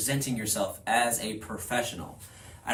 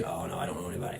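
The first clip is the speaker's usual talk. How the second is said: mock uninterested reply; 6 semitones lower, 7 dB quieter, much faster than usual. Acted reply from a contact.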